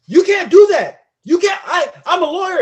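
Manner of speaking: high, loud acted objection; outraged protest. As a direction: protest loudly as the thrown-out lawyer. Acts out lawyer protesting to judge.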